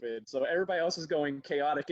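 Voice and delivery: normal talking voice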